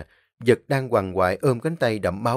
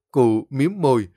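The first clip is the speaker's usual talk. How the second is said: ordinary delivery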